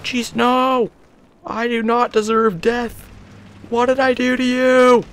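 wounded disbelief at dying; high drawn-out complaint; anguished protest. Real, not acted. Killed again.

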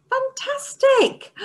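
high slow gushing praise; enthusiastic praise. Shown as a demonstration. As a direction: really gush the praise.

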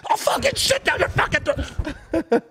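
high, loud enraged shouting; retold furious yelling. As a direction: imitate a raging dad driving someone off. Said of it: "parents are covering their fucking ears".

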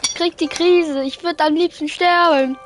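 usual speaking voice

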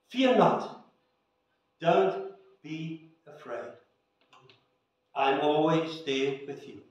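gentle Jesus voice. Acted. Jesus gently reassuring each listener, tender and soft. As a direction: let the comfort settle quietly, like a voice in the ear. Maybe in the whisper in your spirit.

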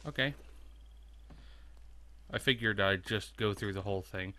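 ordinary talk

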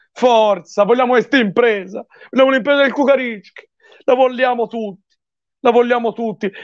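high, rousing chant; rally the team; fervent cheering. Real, not acted. Urging the team on.

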